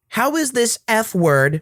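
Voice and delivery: high, scornful mother voice, a bit slow; entitled mother. Acted. Mother scolding the girl about her long hair, high scornful voice. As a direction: lecture her from up high with contempt.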